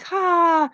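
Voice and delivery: one short, high "ha"; single high mock laugh; imitated ordinary laugh. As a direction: mimic a small, contained laugh. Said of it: "everybody else is like".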